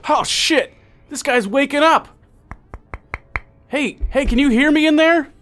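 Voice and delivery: high, loud flustered voice; a startled worker yelling at the glass; panicked lab worker. Acted. Lab worker shouting in alarm at the waking prisoner. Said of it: "you hear the guy go".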